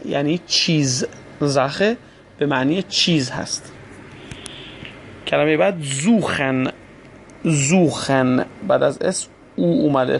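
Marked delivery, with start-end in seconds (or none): 5.8-8.5 زوخن slowly, opening on a buzzed z. Shown as a demonstration. Teacher shows s before u, زوخن slow with initial z. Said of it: "پس بعد اس رو ز بخونیم".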